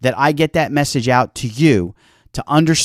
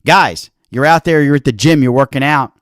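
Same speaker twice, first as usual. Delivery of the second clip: usual speaking voice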